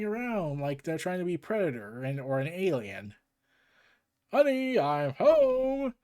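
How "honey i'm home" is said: loud, high, drawn-out homecoming call; sitcom-style greeting. Acted. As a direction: sing out a corny hello. Chimp hero greets with corny line.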